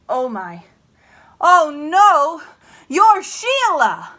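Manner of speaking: loud scold turning to shock; scolding father. Acted. Father yelling at his dirty girl until he recognises her, hammed-up scolding. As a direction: bark at the racket, then gasp.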